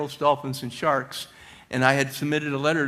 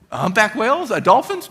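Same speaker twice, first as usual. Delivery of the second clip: excited; 8 semitones higher, 8 dB louder than usual. Retold or imitated. Eager guesses to boss.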